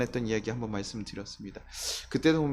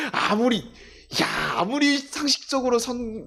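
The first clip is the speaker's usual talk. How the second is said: exasperated laugh; 7 semitones higher, much slower than usual. Real, not acted.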